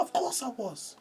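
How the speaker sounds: high-pitched "obviously" protest; squeaky retold indignation; vindicated retort. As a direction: act out an emphatic, self-righteous answer. Retold vindicated answer to mum.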